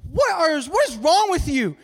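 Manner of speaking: high, loud, slow-stretched disbelief; exasperated objection. Acted. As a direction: argue back at a clueless friend, acted big. Second blind man argues back.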